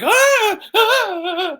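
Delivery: high squeal. Retold or imitated. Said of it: "she's like".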